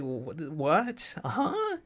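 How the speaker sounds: acted bewilderment, jumping to a high questioning huh; baffled. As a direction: stammer into a high, confused squeak. Acting baffled reaction to scenes.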